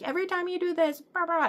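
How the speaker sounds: mocking complainers. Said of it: "I have some people who were like".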